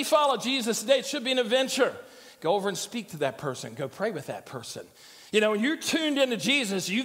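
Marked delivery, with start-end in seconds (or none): 2.4-4.8 soft, low nudge; inner prompting. Acted. Preacher voicing the prompt a follower hears, soft and low. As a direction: give a quiet, low instruction like a thought.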